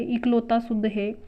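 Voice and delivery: ordinary talk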